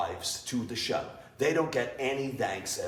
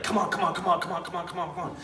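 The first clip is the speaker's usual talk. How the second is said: encouraging yell; 6 semitones higher, much faster than usual. Retold or imitated. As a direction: urge them on like a coach. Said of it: "I'm yelling at you like".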